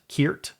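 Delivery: "Kiert" with a hard K and "eer". Shown as a demonstration. Trying out a made-up word, "Kiert" as rising KEERT. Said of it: "would you pronounce that".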